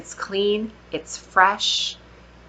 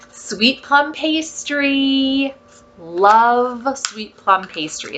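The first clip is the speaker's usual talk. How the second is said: savoring; 4 semitones higher, much slower than usual. Real, not acted. Savoring scent name slowly.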